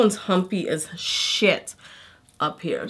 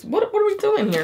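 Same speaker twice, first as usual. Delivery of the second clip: much higher puzzled question; confused surprise; 13 semitones higher than usual. Real, not acted. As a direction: squeak out the confusion. Puzzled at the wig label.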